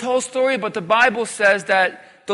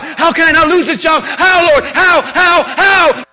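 loud, high, frantic questions to God; anxious pleading shout; 8 semitones higher, 7 dB louder than usual. Acted. Preacher voicing a worried believer shouting at God. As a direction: fire off every how in panic.

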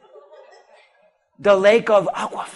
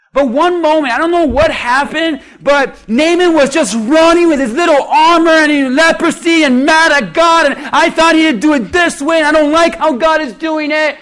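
preaching at full force; 6 semitones higher, 7 dB louder than usual. Acted. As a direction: hammer it home to the congregation. Preacher shouting again.

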